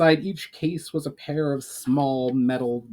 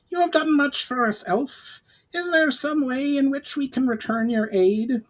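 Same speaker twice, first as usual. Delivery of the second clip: higher, pinched goblin speaking gratefully; higher goblin voice, earnest; 13 semitones higher than usual. A narrator's reading. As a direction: pitch it up like a goblin emissary offering help. Narrator voices Urgar the goblin.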